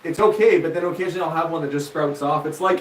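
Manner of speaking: ordinary delivery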